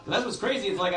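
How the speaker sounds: usual speaking voice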